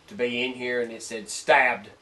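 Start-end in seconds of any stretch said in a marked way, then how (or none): 1.3-1.9 heavy drawn-out southern stabbed; southern accent. Retold or imitated. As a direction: stretch Stabbed in a slow Southern drawl. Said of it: "in, like, a southern voice".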